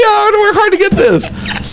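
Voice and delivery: high, laugh-choked mock plea; hammed-up heartfelt pleading; emotional pleading. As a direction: imitate a wounded, emotional protest. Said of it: "he said it with so much emotion".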